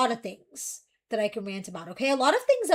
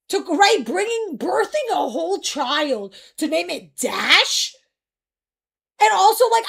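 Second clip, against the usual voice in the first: higher and louder outraged rant; 5 semitones higher, 9 dB louder than usual. Real, not acted. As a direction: rant at full volume, disbelieving. Loud rant about baby names.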